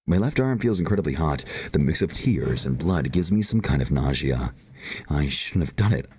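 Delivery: ordinary delivery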